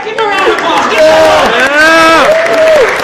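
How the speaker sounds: teacher rallies the room; calling for applause. Real, not acted. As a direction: shout to get the crowd clapping.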